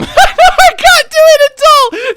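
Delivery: high, loud squeal through laughter; helpless laughing. Real, not acted. Streamer failing the impression, laughing helplessly. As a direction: wail it slowly while cracking up.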